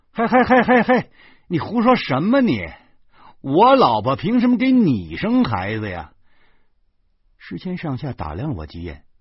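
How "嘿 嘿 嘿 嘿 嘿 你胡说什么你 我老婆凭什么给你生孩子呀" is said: high, loud, laughing hey hey hey; laughing protest. Acted. The narrator loudly protests his friend's insult, laughing in disbelief. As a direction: cut him off, half laughing, half outraged.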